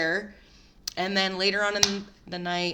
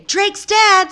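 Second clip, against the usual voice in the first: mock yell; 12 semitones higher, 12 dB louder than usual. Shown as a demonstration. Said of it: "I didn't say".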